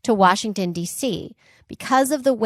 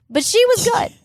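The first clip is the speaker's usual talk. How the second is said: high mock voice; 13 semitones higher, 6 dB louder than usual. Praising the spy, in belle voice.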